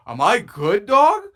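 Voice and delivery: rising high and loud on dog; startled, half-joking panic; hammed-up worried yelp; mock alarm. Retelling heart pounding scare.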